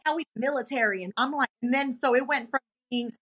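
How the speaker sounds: normal talking voice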